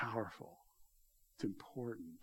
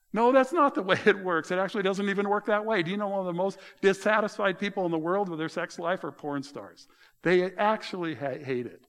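emphatic correction; 7 semitones higher, 7 dB louder than usual. Retold or imitated. Pastor retells correcting him.

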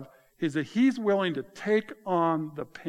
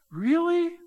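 incredulous; 13 semitones higher, 4 dB louder than usual. Real, not acted. Preacher's disbelief at Jonah.

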